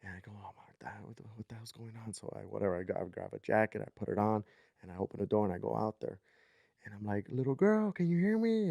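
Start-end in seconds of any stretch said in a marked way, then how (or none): 0.3-2.1 near-whispered, much softer; hushed self-talk. Retold or imitated. Dreamer to himself at the open door, hushed. 7.3-8.7 put-on high-pitched call to a child. Dreamer calling to a crying little girl, calling out.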